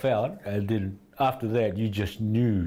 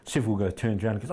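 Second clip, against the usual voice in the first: ordinary talk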